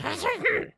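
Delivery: high, loud goblin shriek; goblin screech. Retold or imitated. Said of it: "like, a goblin voice".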